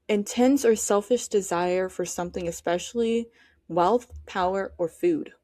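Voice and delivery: ordinary talk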